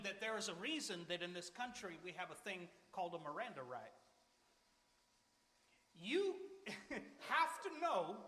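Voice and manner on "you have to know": laughing through the words, slow. Real, not acted. Preacher to congregation, laughing slowly. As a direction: chuckle it out slowly. Laughing while stressing a point.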